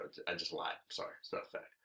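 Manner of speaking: sheepish whispered confession; a guilty whisper off to the side; whispered aside. Real, not acted. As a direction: drop to a whisper and own up. Whispered aside admitting a lie.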